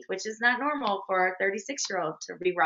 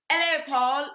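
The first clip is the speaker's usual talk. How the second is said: bad British accent; 6 semitones higher, slower than usual. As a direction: put on a hammy British accent for the greeting. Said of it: "This is my bad British accent".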